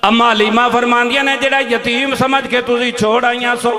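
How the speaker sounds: loud, slow proclamations; full-force preaching. Acted. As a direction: hammer the words home to the crowd. Preached and chanted climax.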